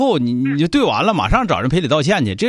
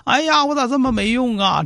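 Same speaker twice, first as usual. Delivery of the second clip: demonstrated plaintive lament, pitch climbing; mock-crying woe-is-me plea; tearful self-blame; 3 semitones higher than usual. Shown as a demonstration. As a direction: put on a weepy complaint, voice rising. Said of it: "你就哭完了，你就说".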